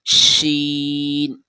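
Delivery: شین slow and drawn out; letter name demo. Shown as a demonstration. Teacher drawing out sheen for pupils. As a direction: hold شین long for the class. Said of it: "اس کی آواز کیا ہوگی".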